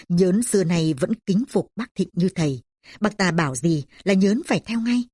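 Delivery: plain reading voice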